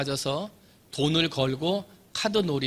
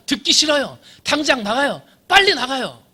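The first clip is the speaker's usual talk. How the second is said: angry yelling; 9 semitones higher, 8 dB louder than usual. Acted. Prisoner keeps yelling at governor.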